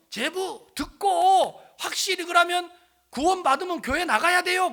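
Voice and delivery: loud, high, emphatic pressing; retold insistent demand. Family pressing brother-in-law about church, loud insistence. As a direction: imitate relatives pinning someone to a promise.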